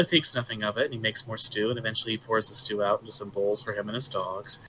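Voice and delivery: ordinary delivery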